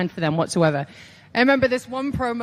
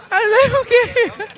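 very high, loud squeak with a laugh; squeaky reluctant agreement; 14 semitones higher, 7 dB louder than usual. Retold or imitated. Scared student accepting an absurd prompt, high squeak. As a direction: squeak out a panicky okay.

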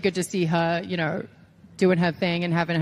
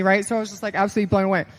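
ordinary delivery

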